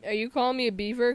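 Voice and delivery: normal talking voice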